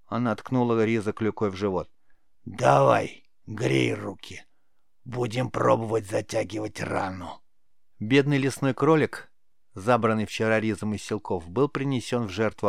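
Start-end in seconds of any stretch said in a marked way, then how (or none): 2.4-7.4 raspy crone giving orders; creaky old witch. A narrator's reading. Witch starts the boy's healing lesson, bossy creaky rasp. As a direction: order the pupil about in a creaky voice. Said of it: "проскрипела ведьма".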